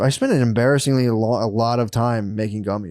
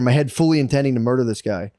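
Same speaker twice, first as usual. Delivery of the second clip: ordinary talk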